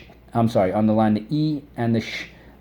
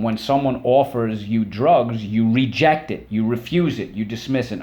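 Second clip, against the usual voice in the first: ordinary talk, nothing marked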